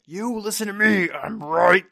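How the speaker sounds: loud, high mock lecturing voice; hammed-up bossy shouting at the listener; mock pushy rant. Podcaster mock-shouting at his audience, hammed-up loud and bossy. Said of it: "maintain the same energy to talk at the microphone".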